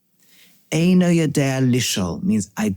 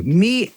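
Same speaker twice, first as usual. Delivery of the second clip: "Me" lifted and pushed hard as the lead word; stress on me; 6 semitones higher than usual. Retold or imitated. Teacher quoting the retort to the cynic, stressing "Me". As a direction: lean on "Me" with a sharp rise, as if pointing at yourself. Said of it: "he emphasized".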